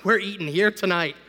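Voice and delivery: loud teasing gang voice; a lightly voiced gang of hungry cats, gloating; mock gleeful cats. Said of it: "all the cats are like".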